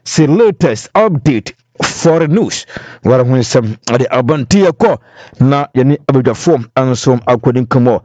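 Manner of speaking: the host bridging into the next segment; radio host link. Acted. Host turning to the callers, in a bright announcer voice.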